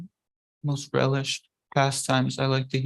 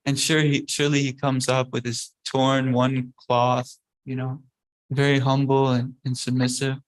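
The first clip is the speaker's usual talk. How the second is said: ordinary talk, nothing marked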